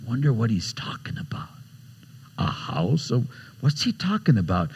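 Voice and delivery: half-whispered puzzlement among friends; hushed wondering. Acted. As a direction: murmur it softly to the one beside you. Disciples wondering among themselves.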